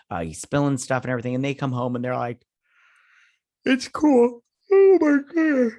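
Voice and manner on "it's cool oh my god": squeaky high put-on voice, drawn out; high mock voice. Vlogger mimicking the characters coming home, high squeal. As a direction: pipe it up high like a cartoon. High far-off mocking quote.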